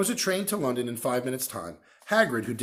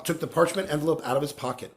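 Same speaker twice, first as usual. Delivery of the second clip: plain reading voice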